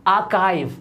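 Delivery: आर्काइव with a K, "AA-kive"; correct pronunciation. Shown as a demonstration. Teacher models for learners, आर्काइव said "aa-kive". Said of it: "ये होता है".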